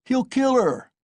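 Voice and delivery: very high alarmed cry; shouted alarm. A narrator's reading. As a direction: cry out in horror. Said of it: "was shouted round him".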